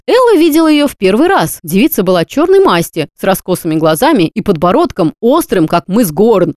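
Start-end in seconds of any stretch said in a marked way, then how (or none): none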